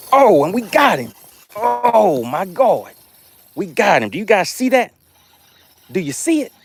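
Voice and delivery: real excitement as the fish bites; fired-up angler showing the catch; excited. Real, not acted. Fish hooked on live stream.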